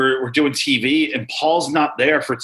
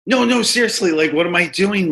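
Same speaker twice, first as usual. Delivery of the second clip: stunned disbelief; 6 semitones higher than usual. Retold or imitated. Wrestler on the phone to Paul, shocked disbelief. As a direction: blurt it out like the news can't be real. Disbelief at babyface turn news.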